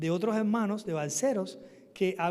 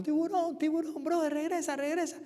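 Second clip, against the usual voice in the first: imitated urgent shout; 9 semitones higher than usual. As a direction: re-enact calling a brother back urgently. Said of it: "le empiezo a gritar a mi hermano".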